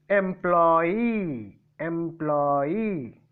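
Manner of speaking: "Employee" with a long, drawn-out final EE; stress on last syllable. Shown as a demonstration. Teacher showing the stress mark, "employee" stressed on its last syllable. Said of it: "on the last syllable I put".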